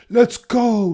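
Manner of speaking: high, loud cheer of let's go; hyped shout. Real, not acted. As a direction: yell it to hype the chat. Hyping a driver.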